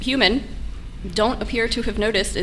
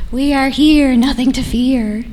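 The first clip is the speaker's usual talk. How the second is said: creepy rhyming voice; 3 semitones higher, 7 dB louder than usual. Pretend. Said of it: "Rhyming, uh, very creepy".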